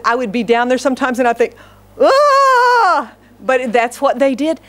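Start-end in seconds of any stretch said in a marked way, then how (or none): 2.0-3.1 long, high-pitched "aah"; mock alarmed cry. Onlooker watching cousins wrestle, mock alarmed cry.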